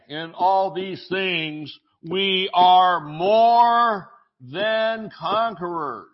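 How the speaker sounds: slow, stretched preacher climax; drawn-out triumphant preaching; preaching at full force. Acted.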